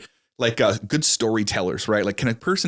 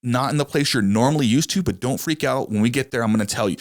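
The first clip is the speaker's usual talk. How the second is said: much higher, bright helpful rep greeting; friendly helper; 4 semitones higher than usual. Retold or imitated. Customer service rep greeting a caller, high and cheerful. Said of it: "you get the person that's like".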